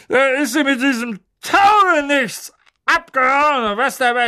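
loud, high, slow bossy aunt; Aunt Marge voice. A narrator's reading. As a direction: boom it out like a tipsy aunt.

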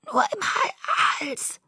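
very slow, high, strangled croak; stammering. A narrator's reading. Half-drowned Bella answering her friend, weak halting stammer. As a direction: break the word and struggle through it. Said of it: "stammelte ich".

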